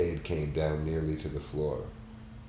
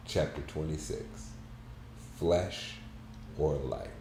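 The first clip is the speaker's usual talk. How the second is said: story narration; 5 dB quieter than usual. A narrator's reading. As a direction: read it like a reflective novel. Reads a novel aloud.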